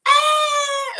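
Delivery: very high, loud cry of despair; panicked mock shriek. The superfan screams in panic, high and loud. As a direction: shriek like a helpless fan.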